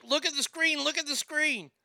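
high insistent repeat; mock urgency pitched high; urgent pointing. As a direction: push her to see it, a little impatient. Retold urging her to look.

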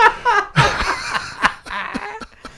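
high breathy laugh; dissolving into high giggles; high squealing laugh. Real, not acted. Cracking up at own story.

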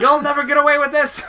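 high, loud hammy hero; cartoon hero defiance. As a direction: declare it like a comic book hero.

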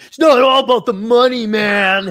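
high, loud mock protest; protesting graduate. Jobless graduate defends his degree to a critic, mock protesting. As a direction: imitate a defensive graduate crying out. Acted janitor protesting to questioner.

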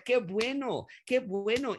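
high, delighted cry of approval; excited exclaim. Real, not acted. Preacher celebrates struggling believer.

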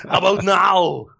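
hammed-up Austrian action-star voice; Arnold Schwarzenegger. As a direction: drag out the punchline in Austrian. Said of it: "in an Arnold Schwarzenegger voice".

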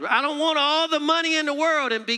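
high, loud, swelling preacher's cry; fiery preacher climax; preaching at full force. Acted. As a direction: lift it up and proclaim it. Preaching surge.

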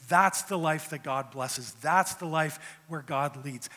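loud, slow, ringing declaration; THAT'S punched loud and slow; emphatic preaching. Real, not acted. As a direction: proclaim it with full force. Preacher emphatic on key line.

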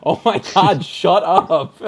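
loud, laughing "shut up" at chatty neighbours; exasperated outburst. Retold or imitated. Fed up with the family talking through the movie, loud laughing outburst. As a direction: snap at the talkers behind you, half laughing. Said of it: "And I'm like".

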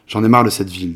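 usual speaking voice

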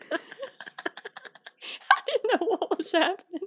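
high-pitched words through laughter; laughing. Real, not acted. Cracking up at the sign-off, laughing. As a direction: barely talk through the giggles.

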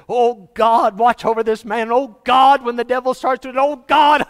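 loud, high fervent praying; loud public prayer. Retold or imitated. Pastor praying over him in a crowded lobby, loud and fervent. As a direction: pray out loud as if nobody is watching. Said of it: "he's getting louder and louder".

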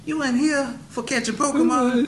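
high, loud disbelieving squeal; incredulous shout. Pretend. Incredulous inmate in prison scene.

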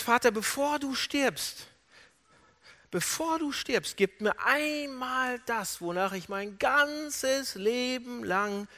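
slow, high, drawn-out entreaty; anguished pleading. Retold or imitated. Jacob pleading with his father before he dies, anguished. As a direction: stretch each plea with longing.

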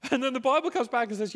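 loud, high, insistent preaching; full-force preaching. Acted. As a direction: drive it home like a pulpit appeal.

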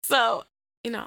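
ordinary delivery

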